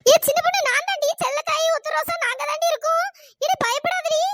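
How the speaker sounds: high, insistent calling; calm a frightened friend from over the panic; urgent reassurance. Acted.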